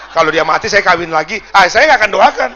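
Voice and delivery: building to a loud, emphatic refusal; mock-outraged preacher at full volume; indignant outburst. Preacher refusing to pray for a careless wife's husband, loud indignation.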